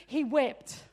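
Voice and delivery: ordinary delivery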